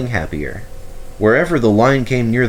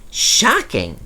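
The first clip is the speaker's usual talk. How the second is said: high, mock shocked tease; teasing; 11 semitones higher than usual. A narrator's reading. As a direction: tease with a wink. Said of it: "said Rex and winked".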